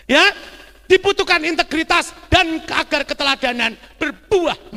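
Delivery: high, loud sermon exhortation; full-force preacher; emphatic preaching. Real, not acted. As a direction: drive the point home to the congregation.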